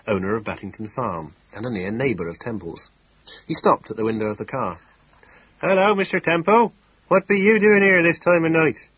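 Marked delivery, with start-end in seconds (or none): 5.6-8.9 high, loud rustic farmer; a jolly West Country farmer hailing a friend. Acted. Farmer Ben voiced in story.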